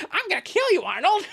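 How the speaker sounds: very high, silly mock threat; hammed-up, high-pitched menace; cartoon threat. Acted threat from a cartoon character.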